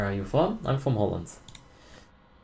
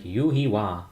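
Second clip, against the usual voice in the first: imitating the Korean girlfriend's pronunciation; Yu-Gi-Oh said the Korean way, "Yu-Hee-Wa"; slower than usual. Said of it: "The way my Korean girlfriend says it".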